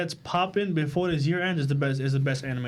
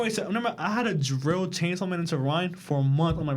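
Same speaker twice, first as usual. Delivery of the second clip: normal talking voice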